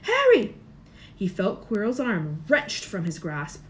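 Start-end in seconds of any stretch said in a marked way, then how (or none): 0.0-0.5 high loud calling; crying out. A narrator's reading. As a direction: call his name from far off.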